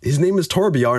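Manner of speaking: usual speaking voice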